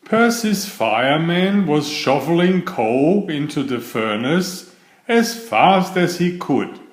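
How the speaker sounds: ordinary delivery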